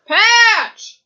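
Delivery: loud, high holler of a pet's name; real high-pitched scream; screaming at the dog. Real, not acted. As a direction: yell to hush a barking dog. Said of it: "I'm screaming into the mic".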